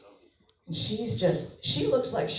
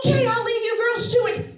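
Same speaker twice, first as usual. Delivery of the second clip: high, loud hurried dad; a relieved dad bolting; dad escaping the talk, high and fast; dad bailing out; 13 semitones higher, 8 dB louder than usual. Retold or imitated.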